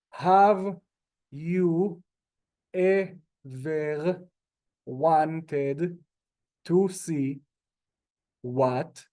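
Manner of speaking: each word read singly with pauses; slow word by word. Shown as a demonstration. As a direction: read it out one word at a time. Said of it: "read this like an American, like an Israeli would".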